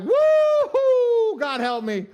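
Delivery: high, loud hammed-up "Woo-hoo"; retelling being slapped with a playful high yell; mock pained yell. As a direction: yelp it out as a joke. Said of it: "I was like".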